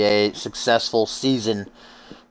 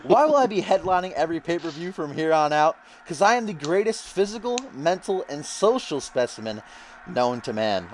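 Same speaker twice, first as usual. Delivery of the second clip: higher-pitched, cocky wrestler promo voice; Russell Pontarelli; 7 semitones higher than usual. Acted. Commentator voices the newcomer's promo for listeners, cocky wrestler voice. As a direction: play a self-important wrestler addressing his crowd. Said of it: "He says".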